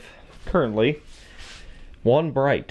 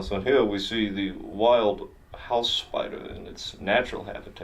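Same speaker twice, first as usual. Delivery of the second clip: hushed, slow nature documentary voice; David Attenborough narration; 4 dB quieter than usual. Pretend. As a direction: narrate like a wildlife film, slow and soft. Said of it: "Bet you weren't ready for a David Attenborough special".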